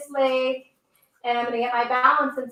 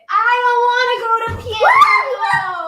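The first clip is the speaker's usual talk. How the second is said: put-on high shouted kid whine, louder; tantrum child; 11 semitones higher, 5 dB louder than usual. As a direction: yell it like a child refusing piano. Voicing angry Amy in yoga.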